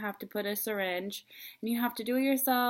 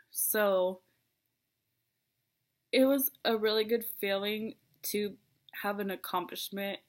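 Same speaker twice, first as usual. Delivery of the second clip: usual speaking voice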